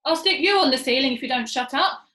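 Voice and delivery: loud, irritated threat; snapped threat. A narrator's reading. Father snapping at his little monkey, loud and cross. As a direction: snap at the child. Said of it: "snapped Muggle Rump".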